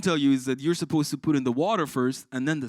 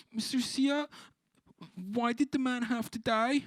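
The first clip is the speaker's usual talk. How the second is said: put-on child voice; 10 semitones higher than usual. Crying child asks teacher.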